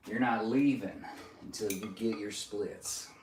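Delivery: softly put-on Southern accent. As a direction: slip into a Southern accent, quietly insistent. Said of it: "I don't know why I'm going Southern accent today".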